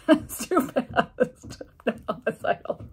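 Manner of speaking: talking through real laughter; amused, words broken by laughs; laughing. Real, not acted. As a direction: crack up at your own quip. Laughs at her own joke.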